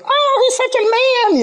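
put-on high, gushing girlish voice; mock swooning girls. Teasing the girls' awe of the scorpion boys, high swoon. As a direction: swoon over him in a high voice. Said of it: "All the girls are like".

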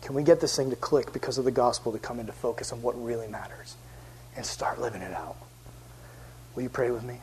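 low, very soft earnest invitation; hushed appeal. Real, not acted. Preacher closing, asking listeners to pray, hushed appeal. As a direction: drop nearly to a whisper as you invite them in.

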